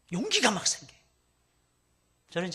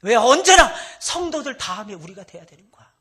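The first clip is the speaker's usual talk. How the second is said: loud high pleading cry; voicing a hurt daughter crying out; anguished loud protest; daughter's tearful outburst; 5 semitones higher, 18 dB louder than usual. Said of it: "우리 딸들이 울면서 얘기하는 거예요".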